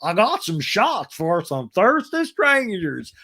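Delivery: high, slow, toothless Texas drawl; a hammed-up Texas saloon host; mock Texas accent. Shannon welcoming the ladies, in a mock Texas accent. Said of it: "Shannon's Texas accent is like".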